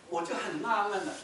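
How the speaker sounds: ordinary delivery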